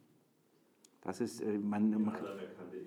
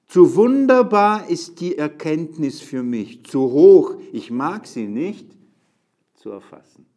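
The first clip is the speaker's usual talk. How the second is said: slow, loud, declaiming psalm; loud slow Bible reading in church; solemn scripture reading; 7 semitones higher, 16 dB louder, slower than usual. Acted. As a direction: proclaim each line to the congregation. Reads verse six.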